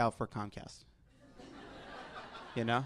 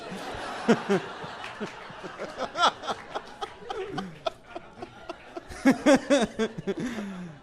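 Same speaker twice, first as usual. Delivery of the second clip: laughter; 7 semitones higher than usual. Real, not acted. Group laughs at joke.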